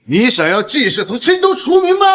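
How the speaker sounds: high-pitched, outraged threatening question; angry threat. Acted. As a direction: threaten him with the voice pushed right up. (高声愤怒威胁)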